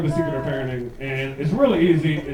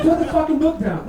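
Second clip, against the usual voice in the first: mock annoyed dad; 9 semitones higher than usual.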